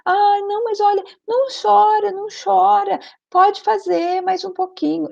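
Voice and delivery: put-on high, pleading coaxing of a crying child; fussing relatives soothing a child in a high voice; family coaxing. Relatives coaxing the crying grandson, high and pleading. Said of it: "as pessoas em volta, né, da família".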